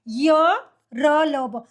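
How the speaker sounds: each letter drawn out slowly, one by one; slow syllables. Shown as a demonstration. Teacher to children, showing how य र ल ब sound, very slow. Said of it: "सीधा हम कैसे उच्चारण करते हैं?".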